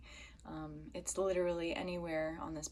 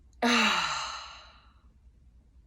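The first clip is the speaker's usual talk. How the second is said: loud voiced "ahh" sigh, falling; moaning sigh; 3 semitones higher, 11 dB louder than usual. Shown as a demonstration. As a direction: vibrate the vocal cords as you let the breath out. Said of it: "Even moaning or vibrating your vocal cords".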